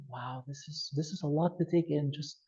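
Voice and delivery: calm the panicking person down slowly; slow, soft calming voice. Shown as a demonstration. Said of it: "See how slower I'm going? I'm slow".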